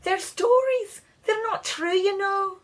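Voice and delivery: very high, scoffing fairy voice; Scottish fairy mocking Louis's belief; scornful Scottish fairy. A narrator's reading. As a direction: sneer it as a tiny fairy. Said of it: "he said".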